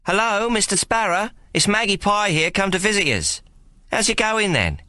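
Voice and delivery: high, lightly voiced young woman calling through a door; a hint of a cheerful girl coaxing; Maggie calling in.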